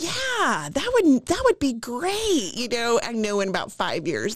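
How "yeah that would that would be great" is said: put-on eager kids. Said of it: "they're like".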